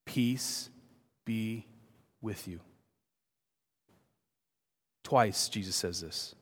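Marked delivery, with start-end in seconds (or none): 0.0-2.6 hushed, low, a pause after each word; very soft and slow, sinking away at the end; tender personal blessing. Acted. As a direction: offer it softly, word by word. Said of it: "and he says it to you this morning".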